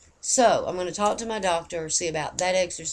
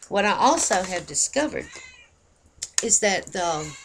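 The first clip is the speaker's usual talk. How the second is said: ordinary delivery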